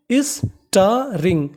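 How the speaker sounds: Starring drawn out very slowly, sta-ring; slow syllable-by-syllable. Shown as a demonstration. Teacher sounding out a vocabulary word, very slow. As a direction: stretch starring so students catch each sound. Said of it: "तो meaning बनी".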